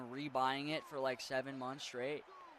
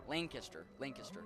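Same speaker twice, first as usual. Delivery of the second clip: "Lane kissed her" quicker each time, softer; speeding up; 4 semitones lower, 8 dB quieter than usual. Shown as a demonstration. Streamer practising a pronunciation tip, speeding up. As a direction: run "Lane kissed her" together toward Lancaster. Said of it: "but very fast".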